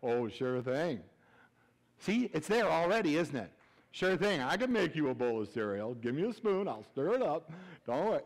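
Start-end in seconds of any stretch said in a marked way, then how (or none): 0.0-1.0 suggestive husband. Acted. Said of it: "He's like". 3.9-8.2 suggestive husband. Acted. Husband answering wife's cereal request, mock-suggestive.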